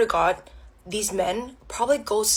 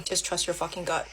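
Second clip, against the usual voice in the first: low, very soft resignation; bitter self-advice; 6 semitones lower, 11 dB quieter than usual. Real, not acted. Woman advises trusting gut feeling, low bitter aside. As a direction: drop low and quiet.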